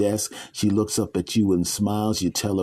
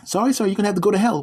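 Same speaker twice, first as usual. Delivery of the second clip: high, fast, chirpy clerk voice; desk lady; 11 semitones higher, much faster than usual. Acted. Gatekeeper lady sending the man to hell, chirpy. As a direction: rattle off the verdict cheerfully.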